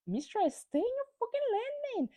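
high, sassy mistress telling-off; mock telling off. Raquel told to stay in her lane, high sassy scolding.

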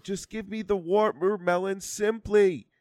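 Simply stressed high and slow; emphatic rant. Real, not acted. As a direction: lean hard on Simply. Ranting about label.

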